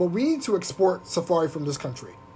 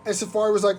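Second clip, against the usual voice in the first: ordinary talk, nothing marked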